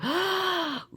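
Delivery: high, quick intake of breath; delighted gasp. Real, not acted. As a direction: gasp as an idea strikes. Sudden delight at blue paper idea.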